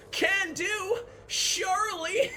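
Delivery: high, slow Southern twang; mock Southern accent. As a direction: drag out "surely" in a put-on Southern accent. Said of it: "approximation of your accent".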